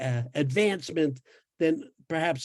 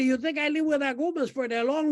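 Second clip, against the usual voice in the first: ordinary delivery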